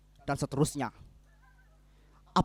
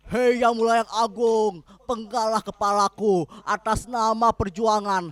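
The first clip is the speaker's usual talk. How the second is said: higher, hammed-up pleading to a king, slowing to the end; mock groveling plea; 5 semitones higher, 4 dB louder, slower than usual. Husband mocking the idea of surrender, higher, slow groveling plea. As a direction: beg a lord for your own beheading, tongue in cheek. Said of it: "dengan seolah-olah bicara".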